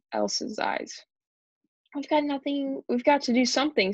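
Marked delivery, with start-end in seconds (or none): 1.9-3.9 mildly raised, urgent voice; urgent shout. A narrator's reading. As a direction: plead for action, half-shouting. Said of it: "shouted Emily".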